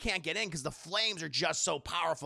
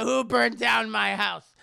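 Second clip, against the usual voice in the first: put-on loud, slow furious drunken yell; drunk angry mom; 4 semitones higher, 9 dB louder than usual. Drunk mom yelling at everyone over her burned house. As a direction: rage like a drunk mother whose house burned. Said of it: "just drunk out of her mind".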